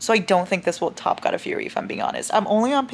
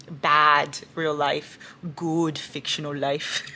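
mock solemn verdict; 3 semitones lower, much slower than usual. Real, not acted. Summing up with mock gravity.

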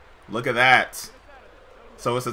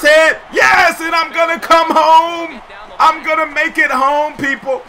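real elation scoring the run; excited shout; 10 semitones higher, 5 dB louder than usual. Real, not acted. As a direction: cheer yourself around the bases.

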